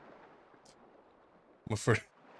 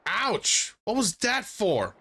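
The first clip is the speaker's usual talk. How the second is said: higher-pitched protest; higher, put-out character voice; hurt dolphin character; 7 semitones higher, slower than usual. Acted. Dolphin reacts to being hit.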